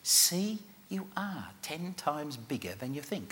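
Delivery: soft, slow, meaningful murmur; meaningful whisper. Shown as a demonstration. Showing the audience how to whisper to a neighbour, softly. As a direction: breathe it to them like a secret. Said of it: "whisper in a very meaningful voice".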